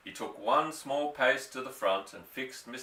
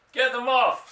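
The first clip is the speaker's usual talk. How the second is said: a high, loud panicked scream; 12 semitones higher, 9 dB louder than usual. A narrator's reading. Mister Hazel seeing birds land on his Rolls, screaming. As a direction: shriek it, horrified for the car. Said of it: "screamed Mister Hazel".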